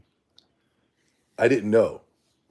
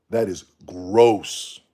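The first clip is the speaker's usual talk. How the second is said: slow disgust; 9 dB louder, much slower than usual. Real, not acted. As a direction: drag it out slowly, loud and disgusted.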